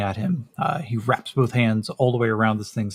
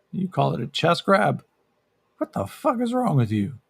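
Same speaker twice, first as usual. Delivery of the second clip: outraged; 6 semitones higher than usual. Acted. Yakeem outraged at Talia.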